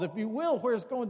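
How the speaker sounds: normal talking voice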